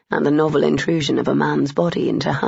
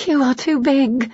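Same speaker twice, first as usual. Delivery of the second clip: Amelia's voice; 8 semitones higher than usual. A narrator's reading. Woman voiced in love scene.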